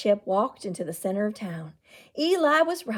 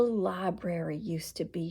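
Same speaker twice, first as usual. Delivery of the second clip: whisper; 5 semitones lower, 8 dB quieter than usual. Acted. Secret told by the fire.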